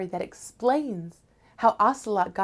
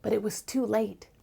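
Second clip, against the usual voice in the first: usual speaking voice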